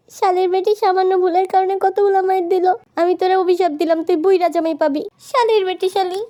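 high-pitched, indignant grumbling curse; aggrieved cursing. Acted. Beaten delivery man cursing the customer, aggrieved.

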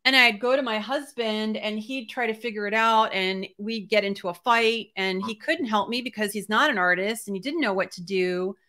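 ordinary delivery

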